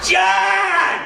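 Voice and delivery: high shouted name, laughing through it. Retold or imitated. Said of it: "I can't make that sound loud enough".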